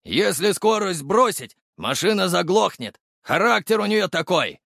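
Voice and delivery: high, slowed, emphatic driver's call; big high-pitched explaining holler; excited shouting. A narrator's reading. Driver shouts explanation while speeding.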